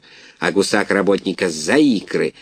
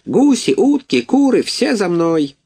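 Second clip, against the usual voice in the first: much higher, rousing call; gander calling out; 11 semitones higher than usual. A narrator's reading. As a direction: summon the whole yard to follow. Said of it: "закричал".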